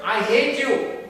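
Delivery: slow, high, forceful shout; loud demonstrated outburst. Shown as a demonstration. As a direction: shout the hurtful phrase slowly. Repeats the hurtful phrase.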